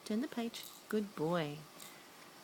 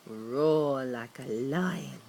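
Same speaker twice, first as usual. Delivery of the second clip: low, slow mock lion growl; lion roar; 6 semitones lower, slower than usual. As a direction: growl it like a playful lion for the child. Reading animal book to toddler.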